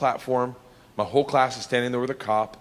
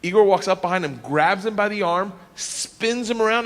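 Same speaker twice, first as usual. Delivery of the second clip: ordinary talk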